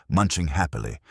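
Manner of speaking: ordinary delivery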